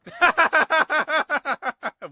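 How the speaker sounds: high, loud burst of real laughter. Real, not acted. Listener laughing at a Scottish saying, high and loud. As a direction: let out a big shocked laugh. Reacting to funeral-wedding phrase.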